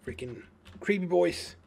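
loud exasperated grumble; fed up with the mobs; annoyed. Real, not acted. Annoyed at creepers.

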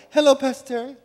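high, bright put-on hello; a hint of an overly polite greeting; forced greeting. Pastor imitating a churchgoer's no-longer-pure greeting. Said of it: "Now you go".

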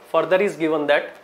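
ordinary delivery

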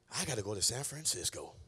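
low, soft retold resolve; quiet resolve. Dad deciding to go find Orlando, quiet resolve.

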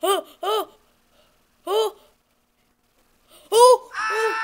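high panicked screams; scared horror game streamer. Real, not acted. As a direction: scream in terror.

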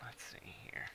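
whispered aside; under-the-breath whisper. Real, not acted. Muttered aside while playing.

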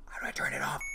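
hushed, voiceless panic; urgent whisper. Real, not acted. Player who can't turn off the alarm, panicked whisper.

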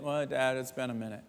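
soft, low, embarrassed mumble; sheepish son. Retold or imitated. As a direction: admit it quietly, caught out. Son sheepishly answering father.

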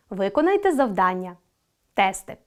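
normal talking voice

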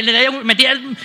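ordinary talk

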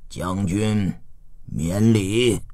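low, soft sovereign voice; low-pitched aged lord; old ruler. A narrator's reading. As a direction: excuse the bow with quiet authority. Aged duke receives his general.

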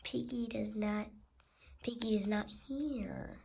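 hushed secret aside; sneaky whisper. Acted.

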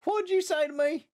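high-pitched mock Southern accent. Newly landed in Texas, hammed-up Southern accent. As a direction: put on an affronted Southern accent. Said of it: "started talking in a Southern accent".

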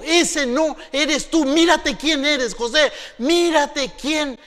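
slow, loud, high jeering; mock him, stretch every word; taunting brothers. Brothers stripping Joseph, slow loud taunt.

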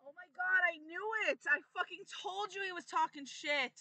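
high, loud outburst; vindicated shouting. Real, not acted. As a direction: shout it, crowing that you were right. Friend vindicated about opponent's trash talk.

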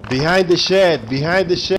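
loud, high position callout; calling out. Pretend. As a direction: call the position out, repeating it urgently.

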